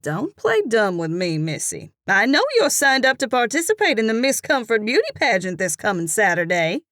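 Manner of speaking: ordinary delivery, nothing marked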